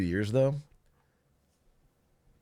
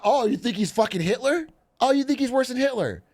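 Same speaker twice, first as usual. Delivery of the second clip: high-pitched imitation of indignant critics; hammed-up high, indignant challenge; mock-serious accusation; 11 semitones higher, 3 dB louder than usual. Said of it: "and they're not".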